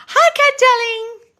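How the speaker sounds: high, loud, slow hello; sing-song greeting. Real, not acted. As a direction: draw the hello out singing. Greeting a viewer.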